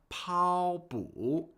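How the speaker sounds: slow 跑步 with first tone on 跑, third tone on 步; wrong tones demonstration. Shown as a demonstration. Teacher showing learners a mistake, 跑 high flat and 步 dipping. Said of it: "not a first tone and then a third tone".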